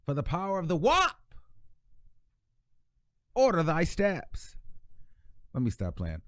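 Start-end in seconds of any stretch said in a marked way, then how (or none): 0.1-4.6 high, drawn-out playful church plea; hammed-up deliverance prayer; mock prayer. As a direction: pray over her in mock solemnity, slowly. Playful mock prayer, then "stop playing.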